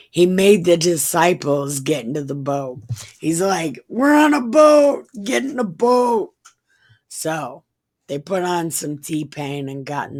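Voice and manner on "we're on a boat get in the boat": high, loud hype call to board; mock-excited high yell; hammed-up shout. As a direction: holler it like a party anthem. Mock shout quoting a boat song.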